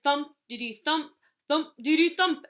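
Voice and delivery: loud thumping drum chant; loud rhythmic drums; marching band drums. A narrator's reading. Marching band rounding corner.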